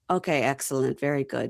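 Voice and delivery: low, soft, hurried brush-off; mock awkward dodging; mumbled dismissal. Host imitating an awkward boyfriend brushing off his girlfriend. Mito dismissing her love talk.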